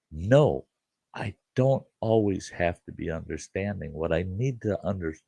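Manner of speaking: ordinary talk, nothing marked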